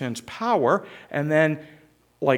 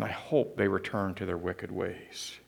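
spiteful hushed wish; 8 semitones lower, 9 dB quieter than usual. Acted. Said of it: "Jonah's thinking".

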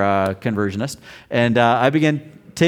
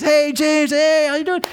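much higher, brighter friend voice calling hello; friend's cheery greeting; 4 dB louder than usual. Retold or imitated. Friend in the dream greets James, high cheerful hello. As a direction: play the dead buddy greeting him warmly. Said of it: "And he says".

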